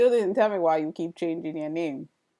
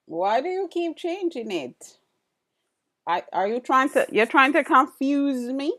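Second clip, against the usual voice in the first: louder, higher, mock-exasperated questions; real playful yell at a chat regular; 7 semitones higher, 6 dB louder than usual. Real, not acted. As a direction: call it out like scolding a teasing friend. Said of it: "I don't know why I'm yelling".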